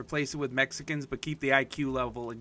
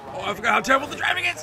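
high, loud and fast cry of dismay; exasperated outburst; 12 semitones higher, 9 dB louder, faster than usual. Real, not acted. Gamer to his viewers about the driving, loud high outburst. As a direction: blurt it out, high and loud, fed up.

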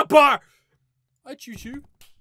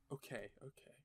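ordinary talk

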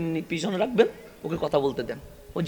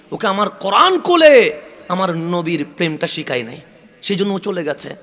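rising sermon fervour; 2 semitones higher, 6 dB louder than usual. Acted. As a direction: swell the plea until it bursts out. Preacher swells at climax.

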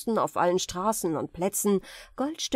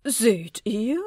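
freudiger Ausruf; 4 dB louder than usual. A narrator's reading. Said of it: "rief der Sultan in großer Freude".

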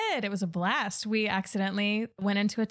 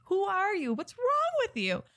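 much higher, incredulous outburst; exasperated retort; 9 semitones higher than usual. Retold or imitated. Telling off a friend who suggested peanut butter, incredulous and high. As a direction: recoil at the very idea. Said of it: "And I said".

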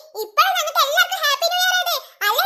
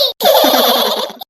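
loud cheering yell; celebratory whoop; 2 semitones lower, 7 dB louder than usual. Acted. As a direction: let the cheer burst into a drawn-out shout.

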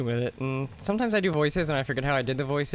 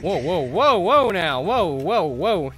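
alarmed; 6 dB louder, slower than usual. Real, not acted. Backing off from NPC knife.

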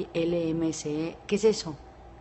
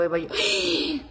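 ordinary talk, nothing marked

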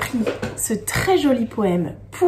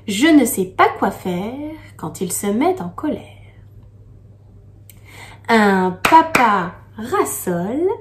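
poem reading. Acted. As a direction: read the verse gently, pausing. Reads the poem to children.

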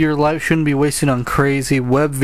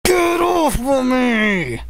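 shouting; 8 semitones higher, slower than usual. Real, not acted. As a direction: shove her off, yelling. Sister pinning him down.

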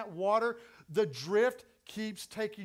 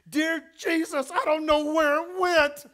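high, loud desperate cry to God; panicked prayer; 5 semitones higher, 9 dB louder than usual. Retold or imitated. Preacher reliving panic on the boogie board, loud frightened plea.

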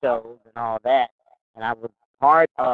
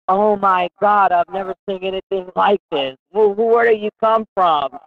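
amazed boss; 6 semitones higher than usual. Retold or imitated. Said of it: "he's like".

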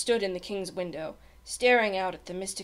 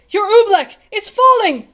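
very high, loud cries; alarmed shout; 12 semitones higher, 14 dB louder than usual. A narrator's reading. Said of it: "he shouted".

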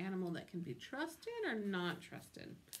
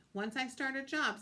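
raised, resolute delivery; firm; 5 semitones higher, 4 dB louder than usual. A narrator's reading. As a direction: state it as a firm principle. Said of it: "said the dentist firmly".

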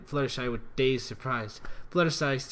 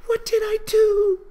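high, loud weeping voice; Fluttershy crying on the floor; sobbing; 11 dB louder than usual. Acted. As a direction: sob it out in despair. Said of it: "She sobbed softly".

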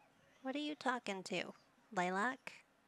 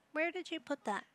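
ordinary talk, nothing marked

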